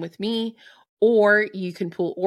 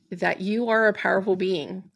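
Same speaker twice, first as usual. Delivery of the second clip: ordinary talk, nothing marked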